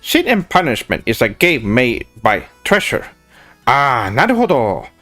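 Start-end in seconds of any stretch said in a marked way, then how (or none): none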